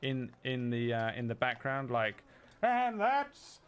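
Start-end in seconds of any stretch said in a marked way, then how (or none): 2.6-3.6 high, slow announcer call. Acted. Announcer in background.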